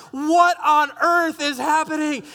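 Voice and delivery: high, drawn-out disbelief; hammed-up bewildered exclamation; incredulous shock. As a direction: stretch the words out in astonishment. His shocked thought at the fight.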